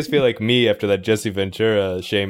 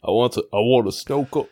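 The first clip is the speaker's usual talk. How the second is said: slightly southern character voice; tentative southern accent. Acted. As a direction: lightly try out a southern accent. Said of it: "you're going, you're going southern".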